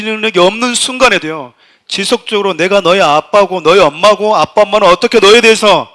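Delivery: 크게 힘주어 설교하듯; 목소리를 높여 회중에게 강하게 역설. Real, not acted. (힘 있게 외치는 설교조)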